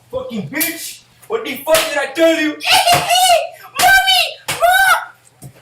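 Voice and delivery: high, shrieking tantrum; mock raging kid. Upset kid gamer yelling for his mom. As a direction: scream for mommy, laughing.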